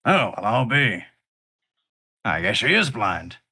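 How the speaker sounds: the lug realizing his mistake; brute surprised. Acted. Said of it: "unsquints his eyes and goes".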